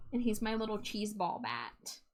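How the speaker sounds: usual speaking voice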